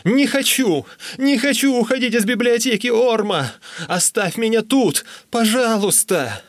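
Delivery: high, loud, frantic protest; loud high begging wail; wailing plea. A narrator's reading. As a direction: cry out as if being dragged away. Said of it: "вопил я".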